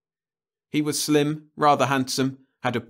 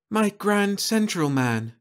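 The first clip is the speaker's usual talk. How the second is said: much higher, slow, awed; as if seeing her dream man; breathless amazement; 12 semitones higher, 3 dB louder, slower than usual. Acted. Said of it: "she breathed in amazement".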